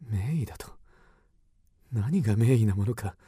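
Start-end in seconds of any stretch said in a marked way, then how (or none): 0.0-0.7 scornful whisper. Acted. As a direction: hiss the question under breath. Scoffs at the doctor in secret.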